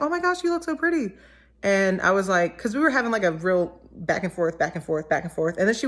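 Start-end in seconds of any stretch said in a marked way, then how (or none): 0.0-1.1 gushing. Retold or imitated.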